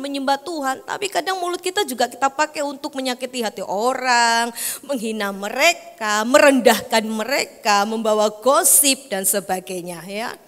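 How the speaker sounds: normal talking voice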